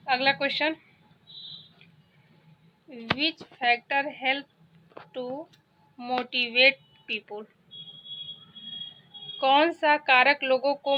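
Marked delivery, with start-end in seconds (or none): 2.9-7.4 each word read out very slowly; slow dictation. Shown as a demonstration. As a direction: read it slowly for students to follow. Teacher reads English quiz question. (धीरे-धीरे पढ़कर बोलना)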